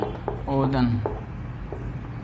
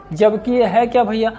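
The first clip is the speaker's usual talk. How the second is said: ordinary delivery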